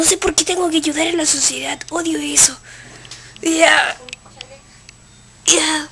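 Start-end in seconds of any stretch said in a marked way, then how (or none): none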